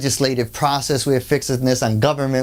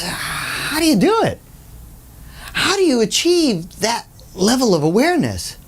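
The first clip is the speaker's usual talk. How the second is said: awed question; 8 semitones higher than usual. Retold or imitated. Ram Dass begging the old lady for her secret, high and awed. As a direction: plead for her secret in wonder.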